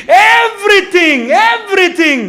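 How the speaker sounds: loud, high, long-drawn exclamation; உச்சக்கட்ட முழக்கம். Real, not acted. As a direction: cry it out, stretching the word as the climax. Climax of list of changes. (உரத்த குரலில் இழுத்து முழங்குதல்)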